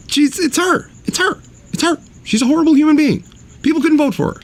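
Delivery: high, heated exasperated rant. Real, not acted. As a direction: rant it, pitch way up. Ranting at Hillary, high.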